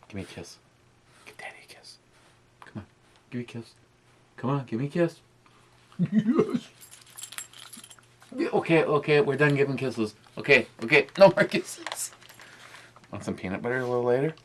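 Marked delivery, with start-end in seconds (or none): 0.1-5.2 soft coaxing pet talk. Real, not acted. Asking dog for kisses. 6.1-12.1 high excited pet talk; squealing while the dog licks. Real, not acted.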